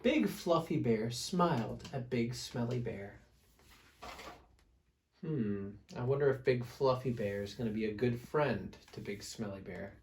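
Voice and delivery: usual speaking voice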